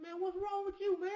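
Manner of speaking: baffled disbelief. Retold or imitated. To the angry friend, a high baffled disbelief. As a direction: sound floored that his buddy's upset. Retold own baffled reply.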